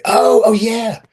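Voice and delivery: loud, high "now I remember"; mock sudden recollection. Wrestler acting out remembering an old conversation, sudden recollection. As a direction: exclaim it as the memory suddenly lands.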